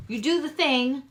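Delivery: usual speaking voice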